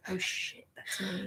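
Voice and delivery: soft, hushed oops; quiet realization. Retold or imitated. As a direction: breathe it out quietly, caught. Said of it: "And I'd be like".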